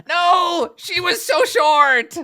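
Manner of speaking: high, loud, slow goblin wail through a laugh. Acted. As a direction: mourn like a squeaky goblin. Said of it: "he's like".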